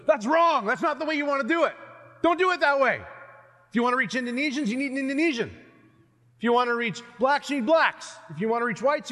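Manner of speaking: high, loud scolding objection; insistent missions expert. Acted. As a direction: lay down the rules emphatically. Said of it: "many a person who studies missions goes".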